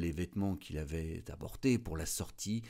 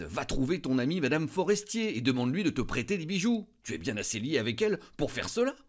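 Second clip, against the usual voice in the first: higher and faster, a sudden bright idea; excited exclaim; 7 semitones higher, 6 dB louder, faster than usual. A narrator's reading. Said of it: "son mari s'écria".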